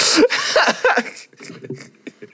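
loud high-pitched cackle; burst of high laughter. Real, not acted. Laughing at his own story, loud high cackle. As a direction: crack up loudly.

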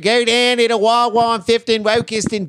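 high, loud hammed-up Philly come-on; Philly accent. Host riffing on Philly Zoom porn, in a hammed-up Philly accent. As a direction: lay on a thick Philly accent.

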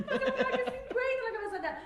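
laughing, mocking. Repeats the line, laughing.

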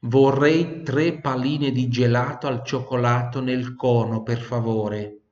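the whole sentence slow and clear. Shown as a demonstration. Teacher repeats sentence slowly for learners to copy. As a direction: read it slowly for repetition. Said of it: "Di nuovo".

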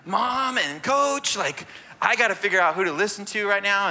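put-on high, loud overwhelmed kid voice; torn kid. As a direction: play a kid pulled between two callers. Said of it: "so the kid's like".

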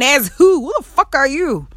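loud, high incredulous challenge; indignant rebuff. Acted. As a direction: throw it at them, high and affronted. Acted rebuff of nosy acquaintance.

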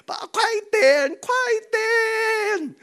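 put-on a frantic baby yelling for dad; crying baby voice. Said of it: "从他的哭就知道了".

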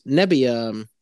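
ordinary talk

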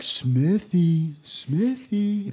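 a lightly voiced sneaky whisper-call; sneaky wake-up call. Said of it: "he was like".

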